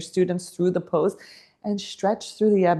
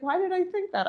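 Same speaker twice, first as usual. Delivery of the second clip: mock puzzled client; 12 semitones higher, 5 dB quieter, faster than usual. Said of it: "he's like".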